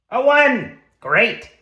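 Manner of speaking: high, loud cry at the dice; excited outburst. Real, not acted. Player seeing a one rolled, loud excited outburst. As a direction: call it out high and punchy.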